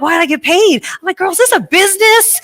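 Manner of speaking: high, loud baffled questions; surprised. Retold or imitated.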